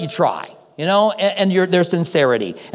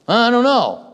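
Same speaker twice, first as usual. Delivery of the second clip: hesitant, higher mumbling as a witness who barely remembers; unsure witness; 6 semitones higher, slower than usual. Pretend. Friend called to the stand, vaguely recalling, hesitant and higher. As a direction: hum and grope for a memory, unconvinced. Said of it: "and then kinda go".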